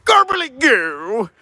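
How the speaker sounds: put-on slow, odd Dana Carvey grumpy old man voice; grumpy old man impression. A vlogger imitates the Saturday Night Live grumpy old man, slow and odd. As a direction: mumble it slow like a cranky old codger. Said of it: "the grumpy old man from Saturday".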